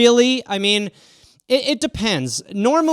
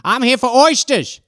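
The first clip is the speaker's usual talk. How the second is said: Oyster Cop; 3 semitones higher than usual. Acted. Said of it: "I'm Oyster Cop".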